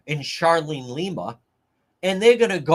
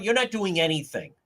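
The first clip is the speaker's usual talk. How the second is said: ordinary delivery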